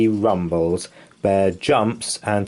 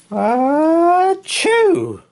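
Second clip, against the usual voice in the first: explosive high sneeze, loud; huge comic sneeze; big sneeze; 7 dB louder than usual. Acted. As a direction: let the sneeze burst out. Bear sneezes in story.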